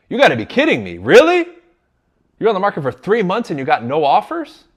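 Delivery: loud, high, outraged disbelief; match their anger, fired up; pissed off. Shown as a demonstration. Agent matching an upset seller, loud and outraged. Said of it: "you gotta be pissed off".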